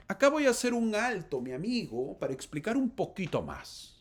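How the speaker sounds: high, loud call to stop; preacher calling a loud stop to the reading; loud interjection. Acted. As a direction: break in high and loud to halt the story. Preacher halts story to explain.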